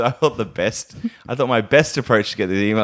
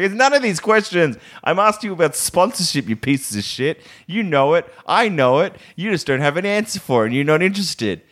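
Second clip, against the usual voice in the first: imagined furious rant; 6 semitones higher than usual. Retold or imitated. Venting at a company that ignores emails, high exasperated rant. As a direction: vent it high and fed up, like a mock blowup.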